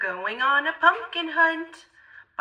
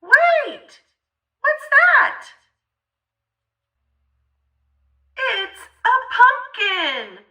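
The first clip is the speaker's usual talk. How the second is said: high, loud thrilled exclamations; excited discovery; 11 semitones higher, 6 dB louder than usual. A narrator's reading. Reading to a child, finally finding the pumpkin: excited cry. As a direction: cry out with joy at the find.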